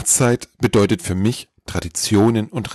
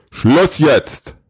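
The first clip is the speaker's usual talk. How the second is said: loud, raised, booming command; booming shout; 7 semitones higher, 6 dB louder than usual. A narrator's reading. Santa silences the endlessly talking elf, booming shout. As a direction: thunder it to cut off the chatter. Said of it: "Mit dröhnender Stimme rief der Weihnachtsmann".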